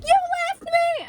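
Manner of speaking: very high, squealed mock complaint; mock accusation. Friend reproaches storyteller for leaving her, mock accusation. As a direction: mimic an indignant friend way up high.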